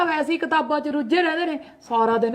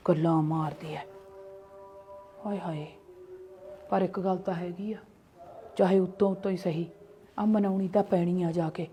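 much lower and softer, under the breath; muttered aside; 5 semitones lower, 6 dB quieter than usual. Acted. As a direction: grumble to yourself so she can't hear. (ਹੌਲੀ ਆਵਾਜ਼ ਵਿੱਚ ਆਪਣੇ ਆਪ ਨਾਲ ਬੁੜਬੁੜ)

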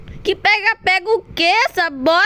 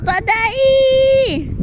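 shout; 7 semitones higher than usual. Real, not acted. Shouted take.

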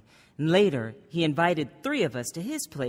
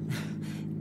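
soft laugh; 9 dB quieter than usual. Acted. Touched by father's pride.

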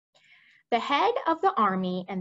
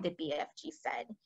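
ordinary delivery

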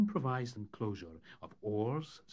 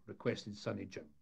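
ordinary delivery

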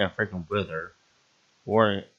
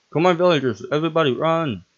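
higher, louder frantic urging; urging shout; 7 semitones higher, 5 dB louder than usual. Real, not acted. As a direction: hurry everyone out, higher and louder. Urging villagers to flee.

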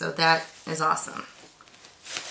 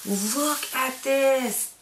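ordinary delivery

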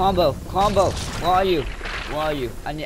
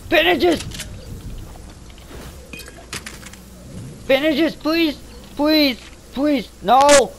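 shrieking much higher and louder; desperate screams; 9 semitones higher, 5 dB louder, slower than usual. Real, not acted. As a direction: beg for your life at the top of your voice.

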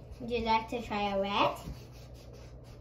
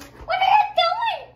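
much higher, loud squealing outrage; mock baby shout; 10 dB louder than usual. Sibling voices the toddler's outraged shout. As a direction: shriek it like an offended toddler. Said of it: "He's like".

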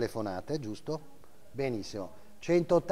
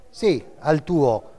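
calling out; 10 dB louder, much slower than usual. Real, not acted. Auctioneer calls to a bidder.

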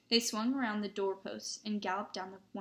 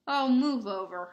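loud, impatient snarl; 3 semitones higher, 8 dB louder than usual. A narrator's reading. Hermione taking charge at the door, snarling. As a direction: snarl it, shoving past. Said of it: "Hermione snarled".

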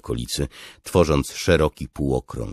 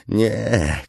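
high, loud cry of refusal; 10 semitones higher, 6 dB louder than usual. A narrator's reading. Captive sees his palace fall, crying out. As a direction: cry out in horror. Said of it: "krzyknął Tanalan".

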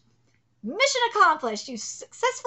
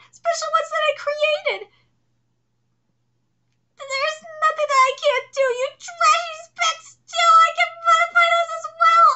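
high, frantic villain voice; the defeated villain refuses to accept it; hammed-up desperate villain; panicking villain; 13 semitones higher than usual. Villain's denial.

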